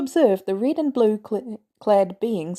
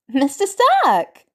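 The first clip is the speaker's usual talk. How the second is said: put-on young boy's voice, higher and louder, slow; youthful reply; 8 semitones higher, 5 dB louder, much slower than usual. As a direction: call out eagerly like a kid. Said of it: "came an incredibly youthful reply".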